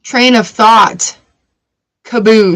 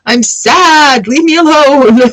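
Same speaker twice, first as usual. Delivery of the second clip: high, slow, louder put-upon yell; hammed-up I'm sad yell; mock grief outburst; 5 semitones higher, 3 dB louder, much slower than usual. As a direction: shout them off, half laughing. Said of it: "And you're just like".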